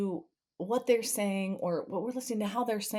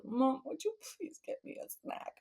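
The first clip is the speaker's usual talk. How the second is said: put-on higher, softer pleading child voice; weepy kid; 9 semitones higher, 9 dB quieter than usual. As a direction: ask sadly after crying all day. Sad son asks for snack.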